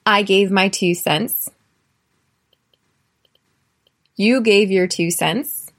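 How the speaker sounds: slow, evenly spaced teacher drill; measured drill voice; slow conjugation drill. Shown as a demonstration. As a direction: pause after each line for learners. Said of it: "work on your conjugation".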